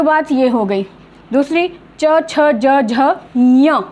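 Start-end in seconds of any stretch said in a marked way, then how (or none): 2.0-3.8 च, छ, ज, झ, ञ one by one, ending on nasal nya; teacher modelling च, छ, ज, झ, ञ; pronunciation demo. Shown as a demonstration. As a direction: recite च, छ, ज, झ, ञ as separate sounds from the palate. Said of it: "इसको भी उच्चारण करते समय".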